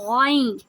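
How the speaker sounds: drawn-out gargled "غائن" from the upper throat; forceful Ghain. Shown as a demonstration. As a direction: push "غائن" out with a throat rasp. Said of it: "زور لگا کر غائن کو نکالتے ہیں". ("غائن" زور لگا کر غرارے جیسی آواز)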